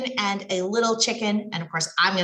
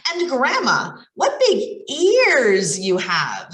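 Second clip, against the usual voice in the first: slow wondering; 3 semitones higher, 5 dB louder, slower than usual. Acted. Girl asks grandma, slow.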